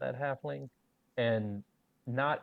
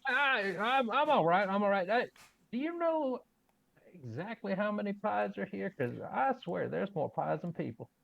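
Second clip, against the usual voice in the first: flustered counter; 9 semitones higher than usual. Acted. Player flustered while counting pies.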